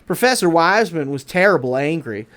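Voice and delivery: plain reading voice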